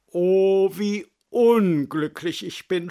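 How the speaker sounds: a touch of sobbing, the Oh loud and sighed. A narrator's reading. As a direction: lament it with a mild tearful catch. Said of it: "schluchzte sie". (leicht schluchzend, das Oh laut geseufzt)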